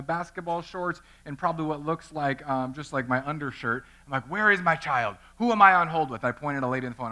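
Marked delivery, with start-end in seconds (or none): 4.3-6.2 frantic demand. Retold or imitated. Dad storms into school office.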